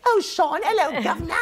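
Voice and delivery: high-pitched Cockney hello; Cockney accent. Acted. Host greeting Sean, high Cockney accent. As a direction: greet him in squeaky Cockney. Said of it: "A little cockney".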